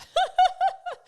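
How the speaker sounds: shrieking giggle; a sudden high real laugh; high burst of laughter. Real, not acted. Laughing at her own joke, high giggle.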